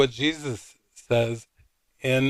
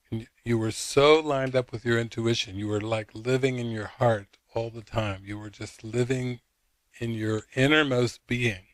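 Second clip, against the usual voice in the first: usual speaking voice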